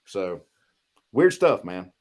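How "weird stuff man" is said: ordinary talk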